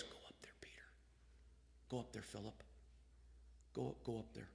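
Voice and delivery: breathy whisper of a guiding voice. Acted. The still small voice urging Philip to go, whispered. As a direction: hush it like a voice in the ear. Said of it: "still small voice that we hear".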